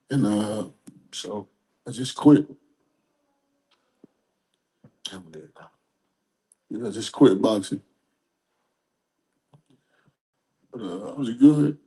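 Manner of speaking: choked up, words broken by long pauses; fighting back tears while speaking; crying. Real, not acted. Former boxer tells interviewer why he quit for his father, crying. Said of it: "I'm not crying 'cause I'm sad".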